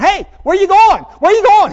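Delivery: high, loud calling after dad; a child's high loud cry of where you going; kid calling out. Retold or imitated. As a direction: shout after him like a worried boy. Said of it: "I hear Evan behind me going".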